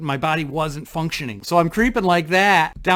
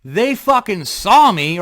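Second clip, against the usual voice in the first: ordinary delivery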